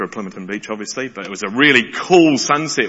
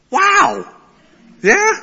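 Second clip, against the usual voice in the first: amazed wow; 5 dB louder than usual. Real, not acted. Awe at the chrysalis.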